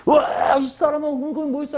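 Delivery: 높고 크게 놀리듯 외치는 목소리; mocking outcry. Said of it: "했더니". (조롱하듯 높게 외치는 소리)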